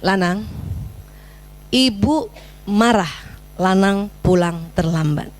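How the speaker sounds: low, slow, controlled scolding; mother's firm, spaced-out telling-off; measured reproach. Shown as a demonstration. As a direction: state the anger calmly, word by word. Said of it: "tegur perilakunya".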